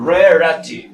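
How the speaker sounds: Rarity said slowly, each syllable clear; deliberate teaching model of Rarity; slow careful pronunciation. Shown as a demonstration. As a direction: take Rarity slowly, first syllable strong. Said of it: "suave, despacio la pronunciación".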